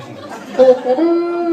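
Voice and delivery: high trumpet call held on one note; imitated trumpet. Man mimicking the Remembrance Day trumpet, high imitated bugle call. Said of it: "I would hear that trumpet play".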